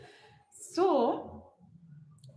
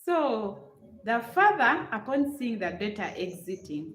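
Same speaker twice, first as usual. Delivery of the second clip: ordinary talk, nothing marked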